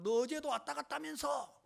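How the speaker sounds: cursing accusation. Retold or imitated. Angry in-law confronts him about yesterday's visit, accusing. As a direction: retell an angry relative's accusation. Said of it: "막 하면서 욕을".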